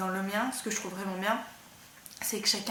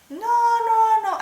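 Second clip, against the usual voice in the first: imitating a Russian accent, much higher and much louder, with a squeaky rise on the middle no; a quick mock Russian no, pitched way up; 14 semitones higher, 15 dB louder, much slower than usual. Sewing teacher brushing off the student's doubt, in mock Russian accent. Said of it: "Elle a un accent russe".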